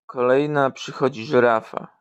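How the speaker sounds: normal talking voice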